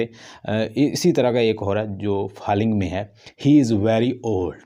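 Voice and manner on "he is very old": put-on HE stressed, voice falls to old, slow; stress on he, falling. Shown as a demonstration. Teacher showing falling tone on a statement. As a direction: lean on He then drop down. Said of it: "he पे stress है".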